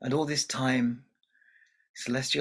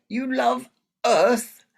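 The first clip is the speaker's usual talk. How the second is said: high, loud, slow outraged disbelief; father outraged at his daughter's choice; 12 semitones higher, 8 dB louder, slower than usual. Acted. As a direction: spit out his scorn. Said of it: "This made even Moon angry".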